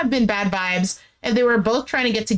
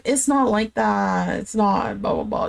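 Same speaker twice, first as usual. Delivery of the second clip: imitated mumbling. He avoids her question, mumbling evasively. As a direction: imitate someone mumbling his way out of it. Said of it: "while mumbling".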